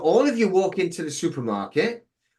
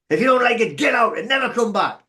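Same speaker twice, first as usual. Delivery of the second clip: higher and louder, get out and never come back; angry telling off; 7 semitones higher, 4 dB louder than usual. Retold or imitated. To the security guard, an angry dismissal, higher and louder. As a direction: throw him out with a raised voice.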